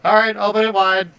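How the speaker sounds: loud, high call to the gatehouse; shouting up. Acted. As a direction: call up to someone on the gate above. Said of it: "shouts up to the gatehouse".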